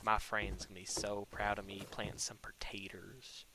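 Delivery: soft whispered aside. Real, not acted. Gamer whispering proudly about planting potatoes. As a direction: whisper it to yourself.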